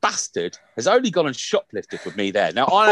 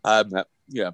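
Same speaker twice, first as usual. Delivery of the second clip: usual speaking voice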